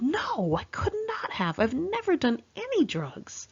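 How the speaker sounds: high, rising protest of innocence; shocked, high-pitched denial; indignant denial. Retold or imitated. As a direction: insist you'd never do that. Denying drug use to friend.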